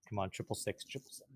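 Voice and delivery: very soft, under the breath; hushed plea. Real, not acted. Player willing the dice before rolling, hushed plea. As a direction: murmur it like a private wish.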